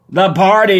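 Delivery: loud, high shout. Acted. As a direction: shout it in frustration. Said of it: "you shout".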